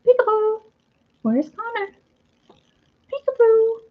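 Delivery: high playful peekaboo voice; peekaboo baby talk. Retold or imitated. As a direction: coo it high like playing with a toddler. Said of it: "you go".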